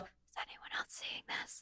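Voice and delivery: hushed whisper to herself. Retold or imitated. Whispering to herself under the yurt roof in awe. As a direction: breathe it out in awe, barely voiced. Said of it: "I kept going to myself".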